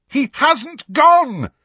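high, drawn-out desperate yell. A narrator's reading. Harry refusing to believe Sirius is gone, yelling. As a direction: yell it out in furious denial. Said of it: "Harry yelled".

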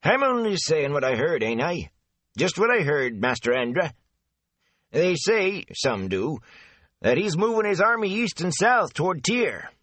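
a garrulous country man passing on rumours; chatty rustic gatekeeper. Acted. Avin defends himself.

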